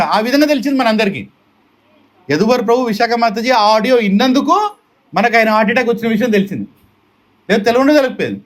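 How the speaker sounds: normal talking voice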